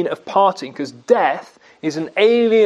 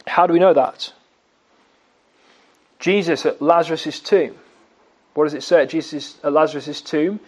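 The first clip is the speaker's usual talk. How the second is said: normal talking voice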